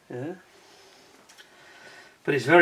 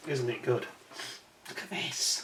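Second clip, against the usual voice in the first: ordinary talk, nothing marked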